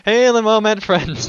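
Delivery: loud, high hearty hail again; booming greeting. Acted. Host rereading the donor's letter, loud and high. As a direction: boom the old greeting out.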